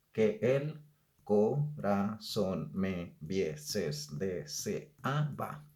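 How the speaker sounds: slow, drawn-out line; slow scansion of the fourth verse; slow verse reading. Shown as a demonstration. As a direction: read the verse very slowly. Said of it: "En el cuarto verso es donde sí vamos a encontrar".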